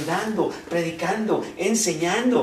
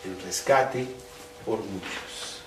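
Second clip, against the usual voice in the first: solemn scripture reading; 6 dB louder than usual. Acted.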